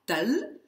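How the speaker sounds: tel high, short E closed by the L; closed first syllable. Shown as a demonstration. Teacher isolating the first syllable for students, tel with short E. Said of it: "dans cette première syllabe".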